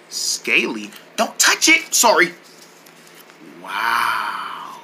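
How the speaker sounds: ordinary delivery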